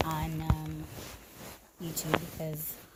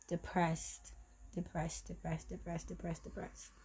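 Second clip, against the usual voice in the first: each depressed softer until it is only breath; fading to a whisper; 11 dB quieter than usual. Real, not acted. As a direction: let the word drain away into a whisper. Repeating word, sinking into despair.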